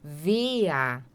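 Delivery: "Wer" with the final R as a short "a", like "vea"; A sound at end. Shown as a demonstration. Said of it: "sonzinho de A no final".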